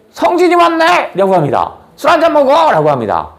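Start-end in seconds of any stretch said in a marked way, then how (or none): 0.1-1.1 put-on very drunk king. Drunk dragon king spotting Seongjin again, very high. 2.0-2.7 put-on very drunk king. Drunk dragon king spotting Seongjin again, very high.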